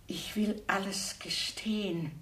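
slow, breathy whisper of the note; a slow, stunned whisper; whispered reading. Acted. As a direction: read it aloud in a shocked hush. Reads the note found beside him.

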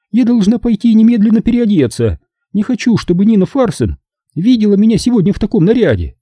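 very high-pitched woman character; flustered hostess. A narrator's reading. Zelda excuses herself to change clothes, high hostess voice. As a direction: hold a high feminine register throughout.

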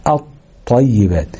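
الطيبات said slowly with a doubled ط and no lam; نطق الطيبات بطاء مشددة بلا لام; assimilated lam. Shown as a demonstration. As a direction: hold the shadda on the ط of الطيبات. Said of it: "هنقوم جايين قلبين اللام لطاء".

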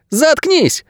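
put-on very high child's voice, interrupting; boy cutting in. A narrator's reading. Boy cutting off Найрод's explanation, high and agitated. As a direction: cut him off in a high boy's voice. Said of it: "прервал его Леви".

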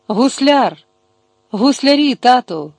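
restrained narrated call to father; mild cry out. A narrator's reading. Daughter spots someone and calls her father, mild cry. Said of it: "скрикнула".

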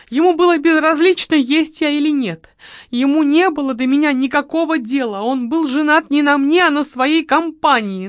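high, loud complaining woman; aggrieved widow. A narrator's reading. Widow complains to the marquis, high and loud. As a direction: pour out her grievances. Margarita's lament.

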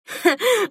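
short, high gloating laugh. A narrator's reading. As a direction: let out a brief mocking laugh. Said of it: "Мила вдруг засмеялась". (короткий злорадный смешок)

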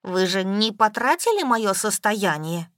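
usual speaking voice

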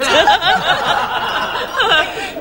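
high, loud rolling laugh; hearty laugh. Acted. Aunt laughing at her own joke about heroes. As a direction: laugh at your own wit.

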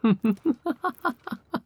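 high, giddy possessive giggle; high delighted laugh. Acted. Captor gloating over Rabi-chan's face, high giddy laugh.

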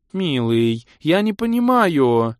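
put-on high, slow, whiny girl; pouting sweetheart. A narrator's reading. Girl confused by her lover's yielding, offended pouting whine. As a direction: whine slowly with a pout. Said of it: "Обиженно надула губы красотка".

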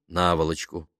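ordinary delivery, nothing marked

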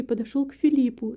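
ordinary delivery, nothing marked

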